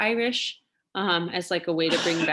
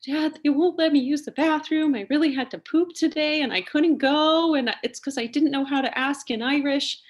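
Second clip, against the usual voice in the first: put-on high, loud child's wail; hammed-up upset kid brother; mock tearful kid; 8 semitones higher, 5 dB louder than usual. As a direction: imitate a little boy complaining to dad in tears. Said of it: "absolutely in tears".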